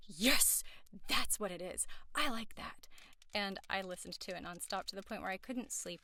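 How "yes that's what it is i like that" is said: gasp, then a loud, emphatic burst; acted-out delighted outburst; excited realization. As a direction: re-enact a thrilled eureka moment, loudly. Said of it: "I was just like".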